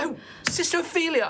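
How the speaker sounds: high-pitched, quick exclamation of recognition; surprised greeting. Acted. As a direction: greet an unexpected friend, accent forgotten. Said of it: "I forget that he has slightly, uh, European accent".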